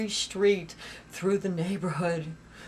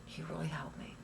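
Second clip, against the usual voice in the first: whisper; 4 semitones lower, 10 dB quieter than usual. Real, not acted. Tearful retelling, quiet repetition.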